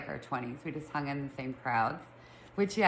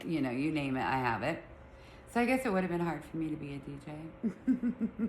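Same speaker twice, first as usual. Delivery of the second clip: usual speaking voice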